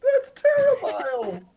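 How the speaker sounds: high, drawn-out squeal; horrified wail. Real, not acted. Game master still reacting to the picture, horrified wail. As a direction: stretch it out in squeaky dismay.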